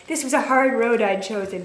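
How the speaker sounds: usual speaking voice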